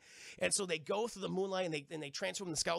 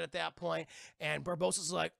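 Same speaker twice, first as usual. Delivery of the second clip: ordinary delivery, nothing marked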